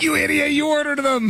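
high-pitched mock outrage; mocking jeer. Imagining co-hosts mocking his belt, mock jeering.

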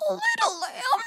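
high, thin, soft squeak; imitated frightened child's whimper; can't find voice. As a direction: struggle to finish, close to crying. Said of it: "I couldn't find my voice".

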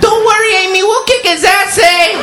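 very high, loud mock Mexican girlfriend; shrieky hammed-up Mexican sidekick; Rosa. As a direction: shout tough reassurance in a caricatured Mexican accent. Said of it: "his Mexican girlfriend, Rosa".

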